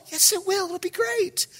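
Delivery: high, hushed, soothing voice; soft reassurance. Retold or imitated. Pastor answering the panicking kid, soft high reassurance. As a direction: placate the kid very softly.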